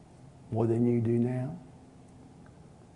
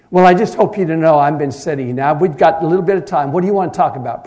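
loud, higher indignant protest; indignant complaint; 4 semitones higher, 11 dB louder, faster than usual. Retold or imitated. Impatient teenager scolds the pastor for keeping her waiting, loud. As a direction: let him have it, offended.

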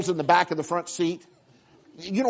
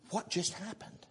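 hushed bewilderment; 3 semitones higher, 15 dB quieter than usual. Acted. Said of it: "he went".

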